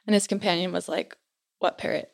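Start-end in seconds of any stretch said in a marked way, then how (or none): 1.6-2.1 hushed puzzled question. Retold or imitated. Companion puzzled about the parrot.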